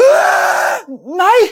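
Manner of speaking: loud crying shout for mom; terrified scream. Acted. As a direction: scream for your mother in fear. Daughter screams at the discovery. (sigaw na umiiyak sa takot)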